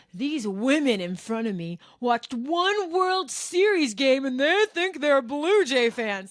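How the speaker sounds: drawn-out condescending jeer; slow, high, loud put-down from a drunk fan; sneering heckler. Retold or imitated. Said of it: "He's like".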